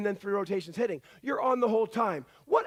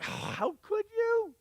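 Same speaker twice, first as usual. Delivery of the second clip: much higher, slow, drawn-out dismay; disappointed parent; 13 semitones higher, slower than usual. Retold or imitated. Parent to a child who failed, slow dismayed reproach. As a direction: let the letdown hang on every word. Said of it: "we might say things like this to our kids".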